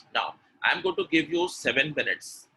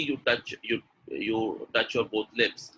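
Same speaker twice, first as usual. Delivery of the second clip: ordinary talk, nothing marked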